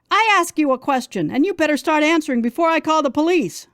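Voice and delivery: high, shrill-edged demand, fast; scream. A narrator's reading. Woman threatening the stranger with the police, screaming. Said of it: "I scream again".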